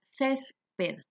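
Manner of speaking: "césped" split slowly into cés-ped; syllable by syllable. Shown as a demonstration. Teacher showing students the accent, "césped" split CÉS-ped. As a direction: say "césped" slowly, pausing after a stressed CÉS. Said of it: "¿dónde lleva el acento?".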